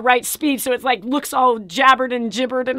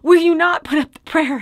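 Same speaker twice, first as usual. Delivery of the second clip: normal talking voice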